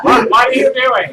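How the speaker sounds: high, loud mock protest; mock protesting skeleton. Skeleton being pulled along by the player, high loud mock protest.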